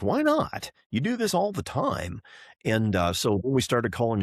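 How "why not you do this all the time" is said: retold disbelief, jumping way up in pitch; high, indignant "Why not?"; incredulous. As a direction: act out your baffled outrage at the refusal. Retold baffled reply to foundry.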